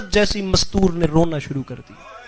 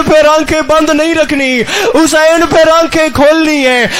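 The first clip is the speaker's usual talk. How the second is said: tearful cry; 5 semitones higher, 5 dB louder than usual. A narrator's reading. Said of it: "رو کے فرمایا".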